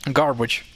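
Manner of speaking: garbage with a hard g; sounds for G. Shown as a demonstration. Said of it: "If you say git, this is the G sound".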